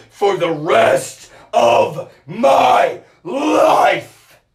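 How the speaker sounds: slow, rising into a cracked, crying shout; anguished crying shout. Real, not acted. Swearing to fight for life, crying out. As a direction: scream the last word in anguish.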